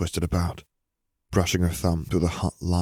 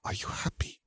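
soft, voiceless whisper; 12 dB quieter than usual. A narrator's reading. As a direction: whisper it close and intimate. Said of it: "Fleur whispered".

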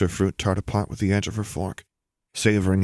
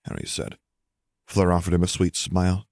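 ordinary delivery, nothing marked

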